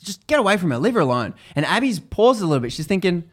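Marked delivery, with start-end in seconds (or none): 0.0-1.3 raised, higher mentor voice; mild mock yell of a protective mentor; imitated yelling. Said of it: "yells, like".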